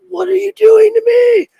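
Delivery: very high, loud complaining shriek; shrieking machine voice. Pretend. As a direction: voice the machine screaming in protest. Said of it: "This serger is like".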